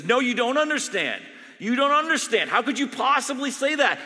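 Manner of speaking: loud, high furious denial; angry retort. Retold or imitated. Dying doctor rejecting the chaplain's sympathy, furious. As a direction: shout her down.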